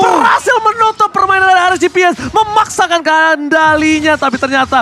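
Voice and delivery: shouted hype play-by-play, high and loud; fighting game announcer. Real, not acted. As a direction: scream the big play like a caster. Caster hyping decisive team fight.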